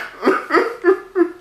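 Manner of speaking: high, pulsing pretend sobs; mock crying. Freddy left alone begins to cry, high hammed-up sobs. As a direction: wail in short squeaky boo-hoo bursts. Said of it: "he began to cry".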